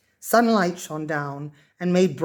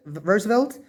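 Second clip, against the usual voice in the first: usual speaking voice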